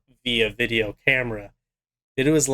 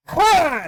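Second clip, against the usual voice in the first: a sudden high, loud mock rat squeal; imitated squeal; 5 dB louder than usual. Retelling the rat's scream.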